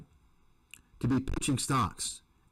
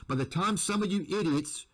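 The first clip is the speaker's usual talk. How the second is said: ordinary delivery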